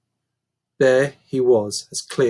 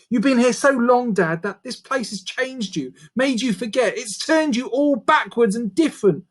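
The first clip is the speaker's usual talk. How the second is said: put-on much higher young girl's voice, urgent; pleading girl; 8 semitones higher than usual. A narrator's reading. Daughter pleads with her changed dad, higher girl's voice. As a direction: beg him to know you.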